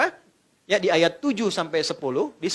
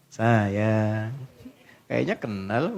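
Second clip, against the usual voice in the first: sweet-talking husband; 10 semitones lower, much slower than usual. Acted. Husband greets sulking wife sweetly.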